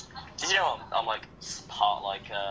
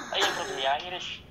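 usual speaking voice

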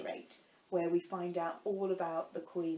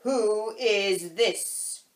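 loud, slow, drawn-out demand; severe; 9 dB louder, much slower than usual. A narrator's reading. Queen questions the Knave about Alice, severe. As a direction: demand it severely, stretching each word. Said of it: "the Queen said severely".

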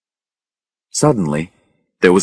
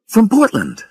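high, hasty interruption; quick interjection. A narrator's reading. Rachel finishes Harry's introduction before he can, said hastily. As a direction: jump in high to cut him off. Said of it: "Rachel said quickly".

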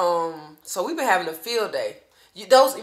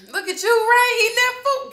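high, loud proud cheering; excited praise; 13 semitones higher, 8 dB louder than usual. Real, not acted. Mom loudly cheering her child eating new food, excited.

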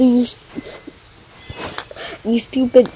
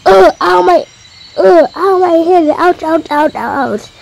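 a higher, hammed-up cry of pain; mock pain; 5 semitones higher than usual. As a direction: play at hurting, whining ow and ouch. Character hurt, crying out.

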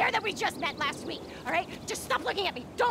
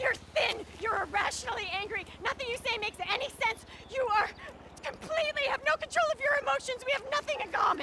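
fast, much higher angry yelling; frantic yelling; 9 semitones higher, faster than usual. Acted. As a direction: fire the insults at him rapid-fire. Woman yelling insults at suitor.

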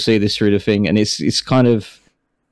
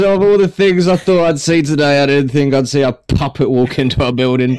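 higher put-on voice, lightly incredulous; amused character voice; 4 semitones higher than usual. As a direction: marvel at it in the character voice. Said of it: "He goes".

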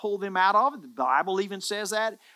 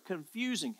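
"confusing" set apart, said softer and falling; word singled out, dropped soft; 8 dB quieter than usual. Shown as a demonstration. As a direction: lift out "confusing" gently, falling at the end. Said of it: "And here's that word".

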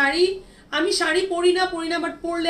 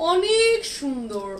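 slow, stretched and higher, savouring each word; drawn-out admiring; 4 semitones higher, much slower than usual. Real, not acted. Seller gushing over a dress to live viewers, slow and drawn out. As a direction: linger on it like you can't get over it.

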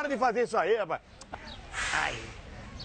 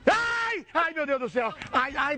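pained yelp; 6 semitones higher, much slower than usual. Acted. Prank victim yelps at rough massage.

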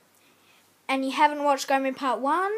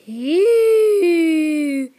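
louder drawn-out ew; disgusted ew; 3 semitones higher, 12 dB louder than usual. Real, not acted. Kid reacting to a toy, loud disgusted ew. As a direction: pull a long grossed-out ew.

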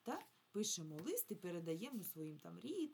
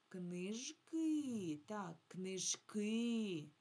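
"книжки" with the final и drawn out; stress on final И; 2 semitones higher, 4 dB louder, much slower than usual. Shown as a demonstration. Teacher showing children where the stress falls, final и stressed in "книжки". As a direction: say "книжки" leaning long on the last и. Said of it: "наголос падає на «и»".

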